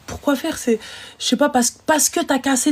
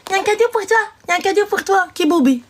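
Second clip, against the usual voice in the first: mocking sing-song voice; 9 semitones higher than usual. Mocking a girl offering a gift.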